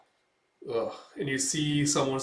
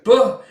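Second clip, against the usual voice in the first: loud, sudden "buh" impact; imitated blow; headbutt noise; 11 semitones higher, 15 dB louder than usual. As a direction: blurt a high, forceful thud sound.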